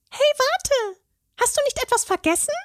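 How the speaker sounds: very high fairy voice, called out; a fairy calling him back; fairy calling after. A narrator's reading. The fairy calls after the leaving troll, high and called out. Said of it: "rief Steffi ihm nach".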